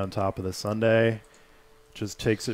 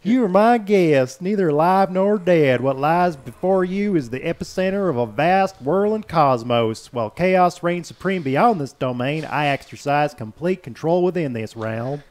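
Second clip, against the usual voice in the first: slow, loud, higher southern drawl; 5 semitones higher, 5 dB louder than usual. Acted. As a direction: drawl it out like a grand Southern host. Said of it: "I'm gonna do this in a bit of a southern drawl".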